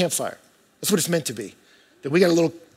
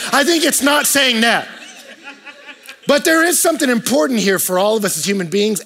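ordinary talk

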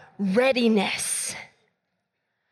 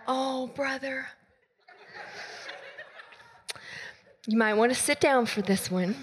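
gentle; 5 dB quieter than usual. Retold or imitated. Gently breaking bad news.

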